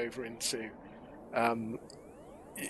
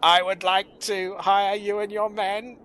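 high, slow monotone Dalek voice; put-on Dalek drone, stretched out and pitched up; Dalek in disguise; 4 semitones higher than usual. Dalek in moustache and beard hiring Ratcliffe, stiff mechanical monotone. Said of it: "a Dalek in a moustache and a beard".

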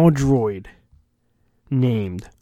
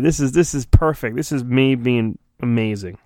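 normal talking voice

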